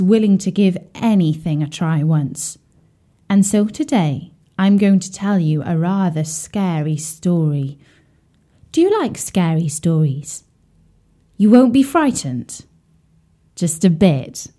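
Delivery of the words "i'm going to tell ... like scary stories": ordinary delivery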